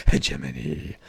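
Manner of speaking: Hegemony, low, purred with a rising end; mock-sinister relish. Gamer relishing his power grab, low mock-villain purr. Relishing the word after laugh.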